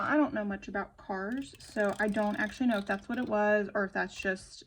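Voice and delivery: ordinary talk, nothing marked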